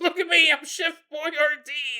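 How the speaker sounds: high, loud clowning voice; mock gleeful boast. Walker joking while poking deer guts, mock gleeful. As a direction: belt it out high, hammed-up and silly.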